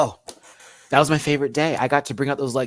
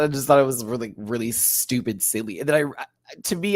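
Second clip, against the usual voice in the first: ordinary delivery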